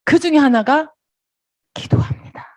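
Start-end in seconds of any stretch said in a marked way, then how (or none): 1.7-2.4 hushed prayer. Retold or imitated. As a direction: breathe it out like a prayer. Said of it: "laughs after punchline".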